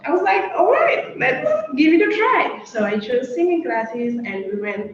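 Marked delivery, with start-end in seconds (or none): 0.6-2.6 eager yes. Retold or imitated. Agreeing to mom, excited.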